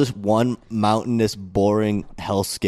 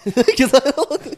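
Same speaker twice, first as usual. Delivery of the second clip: words squeezed out through high laughter; laughing; 14 semitones higher, 3 dB louder than usual. Real, not acted. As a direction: laugh right through the line. Laughs at rant.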